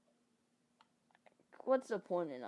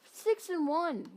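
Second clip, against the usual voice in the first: surprised; 10 semitones higher, 5 dB louder, slower than usual. Real, not acted. Surprised at the record.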